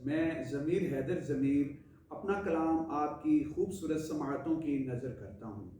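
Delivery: normal talking voice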